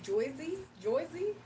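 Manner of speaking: Joyzy with an oy sound, questioning; Joyzy pronunciation. Retold or imitated. Retelling how she misread the name, as Joyzy. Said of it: "But I said".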